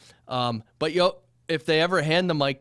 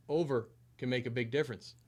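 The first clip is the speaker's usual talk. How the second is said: distant and much softer; six inches off the mic; 11 dB quieter than usual. Shown as a demonstration. Host shows six inches of distance. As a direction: back off the mic a little. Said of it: "even six inches".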